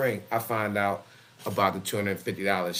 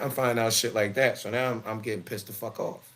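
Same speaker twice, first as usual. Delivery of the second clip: normal talking voice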